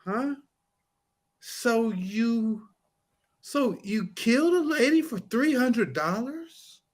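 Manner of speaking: slow, high-pitched incredulous questioning; imitating a baffled cross-examiner; David Rudolph. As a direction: draw each phrase out with long puzzled pauses. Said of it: "when he's cross-examining somebody, he's like".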